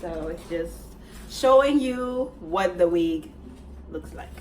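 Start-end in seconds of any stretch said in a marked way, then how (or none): none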